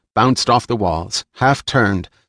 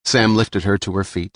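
usual speaking voice

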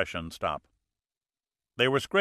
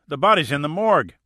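"bodies" higher, louder and rushed; breathless blurt; 5 semitones higher, 7 dB louder, faster than usual. A narrator's reading. Panting deputy urging the sheriff, "bodies" loud and fast. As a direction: push out "bodies" in a panicked rush. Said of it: "Charlie the deputy gasped".

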